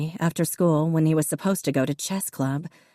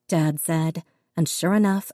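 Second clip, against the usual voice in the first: ordinary delivery, nothing marked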